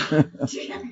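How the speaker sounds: brief, soft chuckle; short laugh. Real, not acted. Speaker amused by his own point to listeners, soft chuckle. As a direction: chuckle quietly at your own remark.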